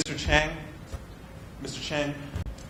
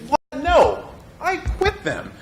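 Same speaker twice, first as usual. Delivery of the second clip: high, loud outraged protest; retold outrage at being asked for the accent; indignant refusal; 13 semitones higher, 7 dB louder, much slower than usual. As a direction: bark it back at the agent in disbelief. Refusing the role to agent.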